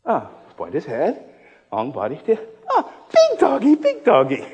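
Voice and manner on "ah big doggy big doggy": put-on little kid. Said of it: "The kid sees a cow".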